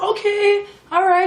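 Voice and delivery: high, fake-bright okay, eager to leave; fake cheerful okay. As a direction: chirp it out, pretending all is fine. Leaving the suspicious spa.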